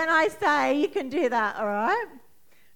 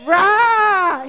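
higher, loud roaring shout; 7 semitones higher, 13 dB louder than usual. Shown as a demonstration. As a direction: roar it out. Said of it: "If your heart is hurting".

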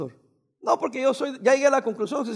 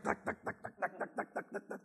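whispered clacking coaster sound; roller coaster clicks; 2 semitones lower, 20 dB quieter than usual. Retold or imitated.

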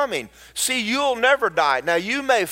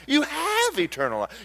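quieter with a laugh in it; softer laughing echo; softer, laughing repeat; 6 semitones higher, 5 dB quieter than usual. Acted. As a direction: say it again softer, delighted.

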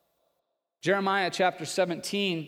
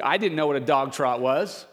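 ordinary talk, nothing marked

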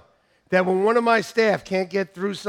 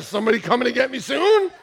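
put-on breath-holding kid; 5 semitones higher, 5 dB louder than usual. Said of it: "I held my breath".

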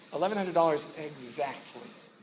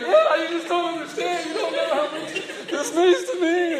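high, loud, blubbering wail; comic tearful wail, pitched way up; mock crying; 12 semitones higher, 10 dB louder than usual. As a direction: imitate yourself sobbing your thanks, hammed up. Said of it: "I'm crying at this point".

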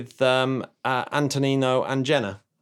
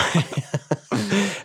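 giggle; 7 semitones higher than usual. Real, not acted. Laughing at own accent.

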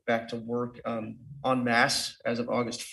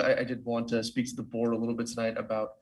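ordinary delivery

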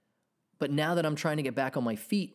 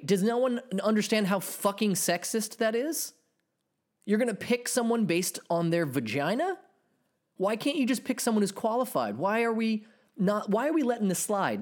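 indignant rant; 9 semitones higher than usual. Real, not acted. Ranting about sexist pick.

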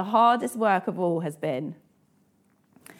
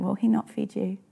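ordinary talk

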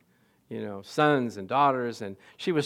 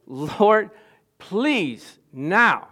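desperate plea; 4 semitones higher, 6 dB louder, much slower than usual. Retold or imitated. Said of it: "final crescent of emotion and saying".

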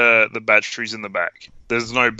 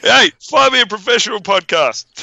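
higher, exasperated mock outburst; mock diva tantrum; 7 semitones higher, 4 dB louder than usual. Host threatens to quit the bullshit show, mock tantrum. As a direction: throw a hammed-up star tantrum.

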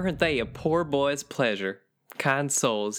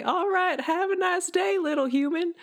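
much higher, sing-song friendly swamp creature; sweet creature greeting. Retold or imitated. Swamp creature greeting a lost little human, much higher and sing-song. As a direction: chirp it up high like a harmless monster. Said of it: "and I'd say".

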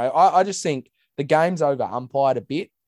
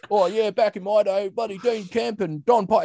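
put-on higher, old-timer reminiscing voice; Mick Malthouse impression; 6 semitones higher than usual. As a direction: lampoon a grizzled old coach on radio. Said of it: "He's like".

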